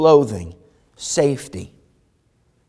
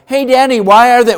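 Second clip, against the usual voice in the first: put-on high, loud child blurting a question; curious kid; 12 semitones higher, 11 dB louder than usual. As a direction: pipe up like a little kid pointing something out. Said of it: "the kids are like".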